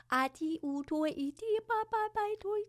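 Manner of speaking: channelled syllables climbing very high; high incantation in an unknown tongue; high-pitched light language. Real, not acted. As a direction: climb into a high, chanted babble.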